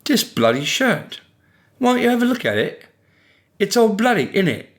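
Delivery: high, put-on English accent. A narrator's reading. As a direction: ham up a jokey English accent. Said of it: "he repeated in an English accent".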